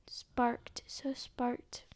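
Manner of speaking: soft, hushed whisper. Real, not acted. Girl reading the dawn page, whispering sparked. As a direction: whisper the word twice.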